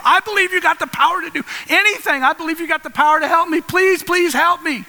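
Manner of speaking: fast, high frantic begging, slowing on the last plea; desperate pleading. Acted. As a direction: gush out promises like someone hanging for dear life. Man on cliff begs God.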